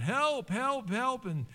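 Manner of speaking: high, pleading cries of help; man hanging from a cliff calls for help, high and pleading; crying out for help. Retold or imitated. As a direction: call up desperately from the cliff, pitched high. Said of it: "he's, like, crying out".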